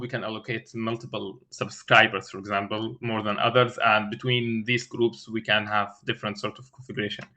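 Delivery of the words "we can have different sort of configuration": normal talking voice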